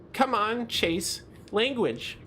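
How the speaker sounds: high-pitched mock reproach; prim chiding. Friend chides Chase for swearing.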